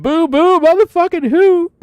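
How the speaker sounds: high, loud mocking taunt; mocking clerk taunt. Clerk taunting the sprayed kid, high loud mocking jeer. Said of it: "I love it".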